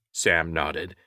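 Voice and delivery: ordinary delivery, nothing marked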